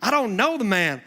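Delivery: high, loud, emphatic denial; acted outburst of Peter denying; swearing denial. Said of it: "Peter swore".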